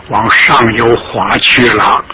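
ordinary delivery

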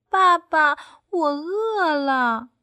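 high, slow duckling whine; hungry duckling asking papa. A narrator's reading. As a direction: drag out the hunger like a tiny duckling.

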